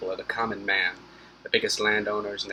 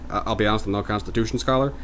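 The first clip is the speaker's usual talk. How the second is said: ordinary talk, nothing marked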